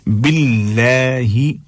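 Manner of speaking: "बिल्लाही" drawn out with a thin light l; thin lam. Shown as a demonstration. Teacher modelling the word, slow "बिल्लाही" with thin lam. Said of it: "बिल्कुल बारीक करके पढ़ना है".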